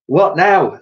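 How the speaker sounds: high, loud chorus-like asking; eager question. A narrator's reading. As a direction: ask it up high and expectant. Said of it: "they all said".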